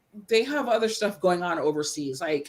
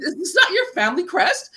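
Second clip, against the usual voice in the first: laughing quote; 7 semitones higher, 4 dB louder than usual. Retold or imitated. Quoting the Irish men, laughing.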